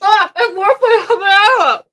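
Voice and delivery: strained, high sour reaction; eating sour candy. Real, not acted. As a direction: react through a sour mouthful.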